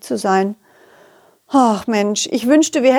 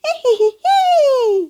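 high squeaky creature giggle. Acted. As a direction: giggle like a tiny toy ball. Ball giggles.

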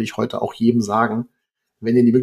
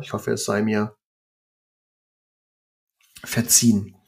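usual speaking voice

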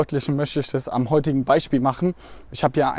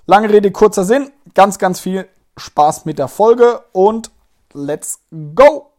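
excited; 5 semitones higher, 8 dB louder than usual. Real, not acted. Upbeat sign-off into episode.

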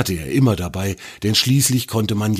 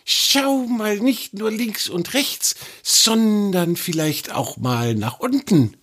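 high, slow snake voice, gently teasing; teasing little snake; 13 semitones higher, slower than usual. A narrator's reading. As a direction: tell the troll to look down, amused. The snake speaks.

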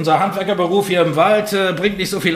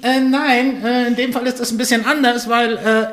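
pixie; 8 semitones higher than usual. Acted. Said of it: "Ich bin eine Fee".

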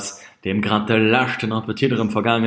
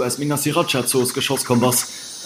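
normal talking voice

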